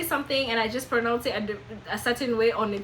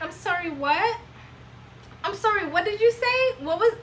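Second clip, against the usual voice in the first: higher, puzzled "I'm sorry, what?"; confused American listener; 9 semitones higher than usual. Retold or imitated. An American who can't understand an immigrant, puzzled voice. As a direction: play the listener who can't follow you. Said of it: "someone is like".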